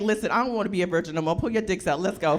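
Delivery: quick pushy urging; fast, eager pleading. Pretend. A virgin offers herself to save her own life, fast and pushy. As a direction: rattle it off, eager to lose it. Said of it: "I'd be like".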